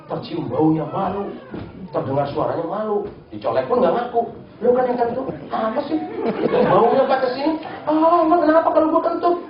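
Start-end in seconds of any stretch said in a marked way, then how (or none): 4.6-9.4 high, indignant back-and-forth; bickering farter. Acted. Acting out two friends arguing over a fart, high indignant bickering.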